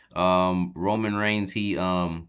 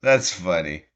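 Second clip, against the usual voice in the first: delighted outburst; 5 semitones higher, 9 dB louder, slower than usual. Real, not acted. Laughing over the slipper.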